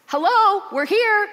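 high, loud hailing call; a loud, high call of hello. Acted. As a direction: shout across to someone who can't see you. Characters calling to unseeing dwarves.

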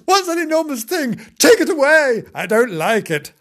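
high, loud pleading panic; frightened kitten. Acted. Kitten wanting the car taken away, frightened. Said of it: "frightened poor Timbo".

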